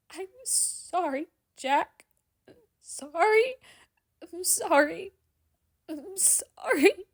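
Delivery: high, broken, tearful apologies; crying, repeating. A narrator's reading. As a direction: keep sobbing it over and over. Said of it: "repeats in between tears".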